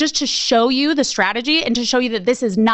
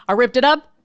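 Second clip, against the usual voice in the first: normal talking voice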